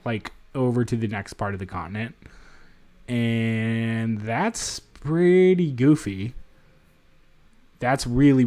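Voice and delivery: usual speaking voice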